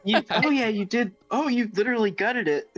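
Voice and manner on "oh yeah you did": ordinary talk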